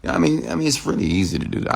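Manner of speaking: ordinary delivery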